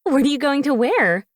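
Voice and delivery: high, loud and bubbly; excited. A narrator's reading. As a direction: gush it like a thrilled friend. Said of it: "she asked excitedly".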